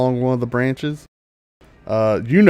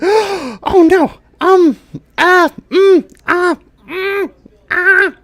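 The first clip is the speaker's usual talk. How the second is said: very high, loud little squeaks; flustered sprite; 8 dB louder, much slower than usual. Acted. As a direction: squeak in broken bursts of alarm. Sprite reacts in alarm.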